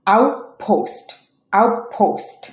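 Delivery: আউটপোস্ট said "aup-post", no t; assimilated T. Shown as a demonstration. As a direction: merge the t of আউটপোস্ট into the following p. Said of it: "এটা উচ্চারণ হবে".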